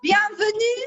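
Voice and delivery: high, loud French welcome. Shown as a demonstration. Host welcoming the streaming audience in playful French. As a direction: sing out the greeting in French. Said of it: "It sounds much nice in French".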